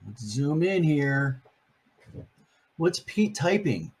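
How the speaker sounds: ordinary delivery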